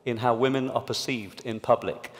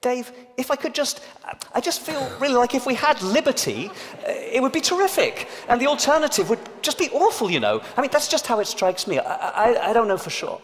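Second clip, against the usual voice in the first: mock a woman tiptoeing so as not to seem aggressive; hammed-up timid meeting voice softening every claim; hedging non-aggressive woman; 12 semitones higher, 4 dB louder than usual. Shown as a demonstration. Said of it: "a woman would have to say".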